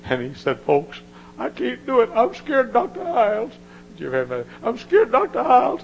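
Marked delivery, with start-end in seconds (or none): 0.6-3.5 high, loud frightened plea; scared young preacher. Retold or imitated. Preacher voicing a young preacher who is scared to preach, high and frightened. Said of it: "He was scared to death". 4.6-5.8 high, loud frightened plea; scared young preacher. Retold or imitated. Preacher voicing a young preacher who is scared to preach, high and frightened. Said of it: "He was scared to death".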